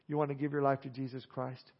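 ordinary talk, nothing marked

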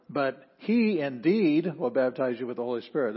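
he indeed pushed out strongly at the start; emphatic "he indeed". Shown as a demonstration. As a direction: stress he indeed, then ease off. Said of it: "would also show the emphasis".

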